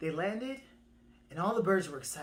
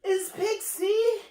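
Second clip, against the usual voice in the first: very high, loud, drawn-out words; squeaky bird; 8 dB louder, slower than usual. Acted. Second bird declares new name, very high proclamation.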